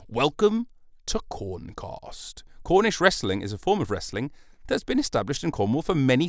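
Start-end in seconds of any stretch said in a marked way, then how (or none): 0.1-2.4 very slow, higher mock welcome; podcast intro. Host of an invented Cornish podcast, grandly drawn-out. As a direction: draw out each word of the greeting.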